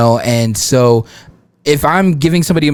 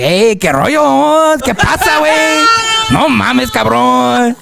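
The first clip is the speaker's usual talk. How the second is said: exaggerated Mexican Spanish; 10 semitones higher, slower than usual. Shown as a demonstration. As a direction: stretch it out like a caricatured Mexican guy. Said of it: "I can do your typical".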